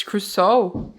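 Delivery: "Crusoe" in two syllables, ending in soh; pronounced Crusoe. Shown as a demonstration. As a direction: say "Crusoe" with a long final oh. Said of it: "According to Google".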